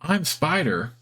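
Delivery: bouncy little spider. Acted. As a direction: bounce up on it happily. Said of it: "It bounces a little when it sees you".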